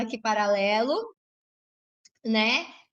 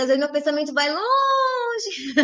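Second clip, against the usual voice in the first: drawn-out playful; slower than usual. Real, not acted. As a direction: stretch LONGE out long and slow. Elongates "longe" jokingly, then laughs.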